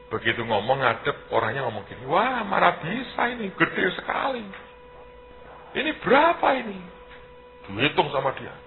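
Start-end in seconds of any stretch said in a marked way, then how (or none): 2.1-6.8 an amazed mayor's man, voice pitched high; mock astonishment. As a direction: play the stunned official, pitched up. Imitating astonished official.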